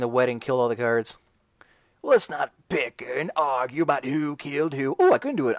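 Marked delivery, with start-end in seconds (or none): 2.0-4.9 put-on English accent; hammed-up English voice, movie-quote style. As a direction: try an exaggerated English accent. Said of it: "can do an English accent".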